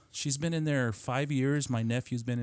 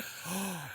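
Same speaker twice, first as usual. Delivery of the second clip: mock gasp of shock; 6 semitones higher, 11 dB quieter than usual. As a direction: suck in air like a stunned onlooker. Imitates shocked kids' reaction.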